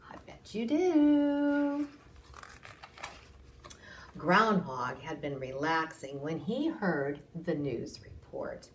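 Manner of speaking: usual speaking voice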